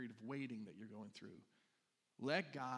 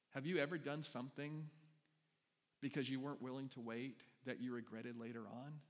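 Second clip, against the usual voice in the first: normal talking voice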